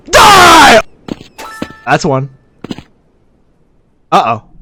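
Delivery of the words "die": high, loud shout of attack; battle cry. Real, not acted. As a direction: shout it as you strike. Attacking an enemy in game.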